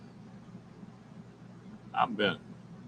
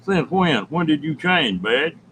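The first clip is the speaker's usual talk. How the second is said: surprised; 8 semitones higher, 6 dB louder, slower than usual. Real, not acted. Teasing a viewer in chat.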